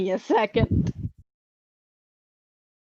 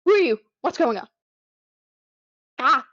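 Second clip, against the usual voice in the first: confused goblin; 4 semitones higher, 4 dB louder, faster than usual. Acted. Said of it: "he looks around very confusedly".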